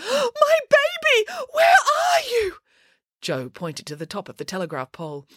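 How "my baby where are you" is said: shrieking high, long-drawn cry; gasp, then wail for a lost pet; distraught wail. A narrator's reading. Older woman searches for her cat, wailing.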